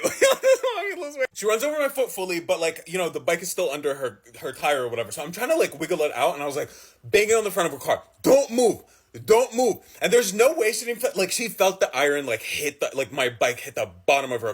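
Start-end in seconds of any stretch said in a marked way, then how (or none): 0.2-1.3 very high, giggling delivery; high laughing punchline; laughing mock voice. Repeated joke in silly voice. 8.2-9.8 higher, louder commands; urgent repeated order; urgent warning. Retold or imitated. Banging on car, warning.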